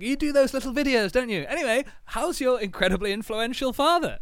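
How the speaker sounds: high-voiced mock admirer; acted stranger. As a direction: pitch it way up as the pestering fan. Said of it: "He was like".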